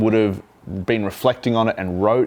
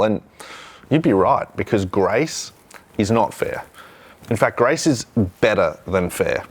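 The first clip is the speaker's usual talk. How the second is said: ordinary talk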